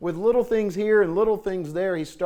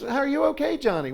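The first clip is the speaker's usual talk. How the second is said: mom calling; 9 semitones higher than usual. Retold or imitated.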